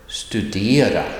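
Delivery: "studera" with the tone going up on "de"; rising tone accent. Shown as a demonstration. Teacher gives one example of accent one, rising tone on "studera". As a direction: lift the pitch on the middle of "studera". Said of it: "a rising tone".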